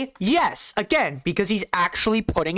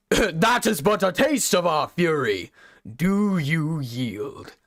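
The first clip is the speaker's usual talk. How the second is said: slow, grave warrior-king imitation; gravelly kingly voice; slower than usual. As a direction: deliver it like a solemn king. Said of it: "the same gravelly, like, gravitas, kingly voice".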